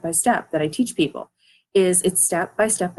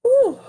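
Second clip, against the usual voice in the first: playful high ooh; 6 dB louder than usual. Real, not acted. Teasing the listeners playfully.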